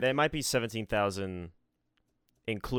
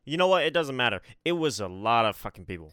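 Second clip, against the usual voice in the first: exasperated outburst; 6 dB louder than usual. Real, not acted. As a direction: throw up your hands and shout it. Exasperated outburst ending debate.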